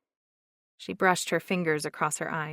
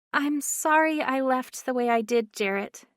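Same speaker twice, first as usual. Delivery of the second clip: timid; 6 semitones higher, 4 dB louder than usual. A narrator's reading. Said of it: "she said in a timid voice".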